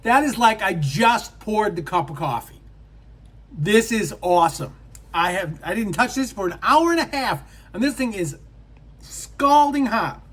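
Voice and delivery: amazed and loud, rising high; thrilled product reveal; excited. Real, not acted. Amazed the coffee stayed hot.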